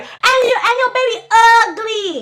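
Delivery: very high, stretched-out taunt; sling it high, dragging the last word; imitated jeering guest; shrieked insult.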